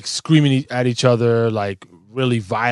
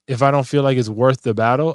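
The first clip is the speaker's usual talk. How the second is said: ordinary delivery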